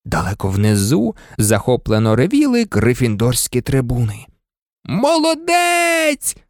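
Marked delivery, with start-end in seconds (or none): 4.8-6.4 high, long-drawn holler of praise; bawled cheer. A narrator's reading. Wood cheering Harry from below, bawling. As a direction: stretch the word into a high yell. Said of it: "горлав Вуд".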